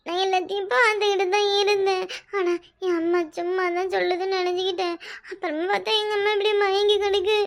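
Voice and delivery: whining, tearful put-on explanation; funeral lament. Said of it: "இப்படி ஒப்பர் வைக்கிறது". (அழுகையோடு புலம்பும் விளக்கம்)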